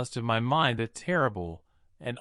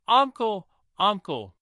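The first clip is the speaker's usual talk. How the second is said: high, louder cry of alarm to the uncle; frightened cry; 7 semitones higher, 3 dB louder than usual. A narrator's reading. Nephew crying out to his uncle in terror. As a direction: call out to him in sudden fear. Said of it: "I cried".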